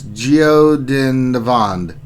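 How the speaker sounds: Giodinavand drawn out very slowly, sound by sound; careful attempt. Shown as a demonstration. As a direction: sound out Giodinavand with long, stretched syllables. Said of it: "gonna try to say this".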